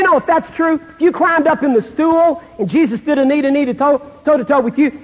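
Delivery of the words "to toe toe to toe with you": ordinary talk, nothing marked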